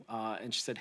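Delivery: usual speaking voice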